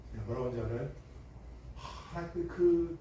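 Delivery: ordinary delivery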